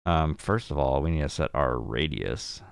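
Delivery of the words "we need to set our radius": normal talking voice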